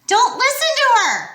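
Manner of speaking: high, loud warning shout. A narrator's reading. Annabeth warning Percy from hiding, shouting. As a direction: yell it across the garden. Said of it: "Annabeth's voice shouted".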